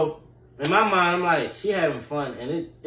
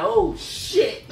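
startled; 4 dB louder than usual. Retold or imitated. Startled by her fighting challenge.

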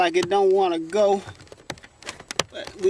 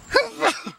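quick squeaky burst of laughter; a giggle at himself; amused little high laugh; short high laugh. Real, not acted. Sneezes, laughs at blessing self.

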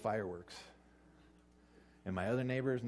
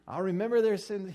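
mock husband grudge; 9 semitones higher, 7 dB louder than usual. Mock husband selective memory.

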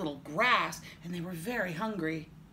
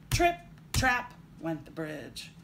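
ordinary delivery, nothing marked

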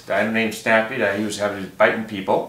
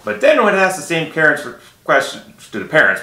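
usual speaking voice

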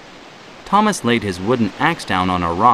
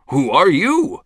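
startled; 8 semitones higher, 3 dB louder than usual. Acted. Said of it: "Thomas was startled".

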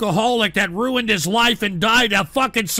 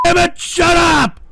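high, loud command; furious shout; 6 semitones higher, 4 dB louder than usual. Real, not acted. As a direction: yell to silence the hecklers. Host silences the chat.

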